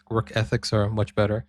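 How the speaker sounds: usual speaking voice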